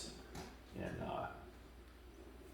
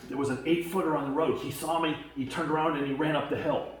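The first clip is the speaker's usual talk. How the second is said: higher and louder, breathless rush; excited report; 10 semitones higher, 8 dB louder than usual. Retold or imitated. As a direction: blurt out what he just saw. Friend's urgent report retold.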